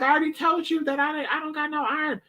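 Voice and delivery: high, whining mock cousin; whiny cousin. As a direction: whine it up high. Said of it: "he's like".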